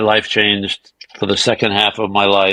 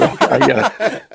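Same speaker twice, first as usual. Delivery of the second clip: laughing; 8 semitones higher than usual. Retold or imitated. Realizing the [name] connection.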